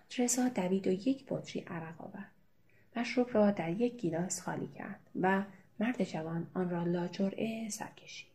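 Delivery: ordinary delivery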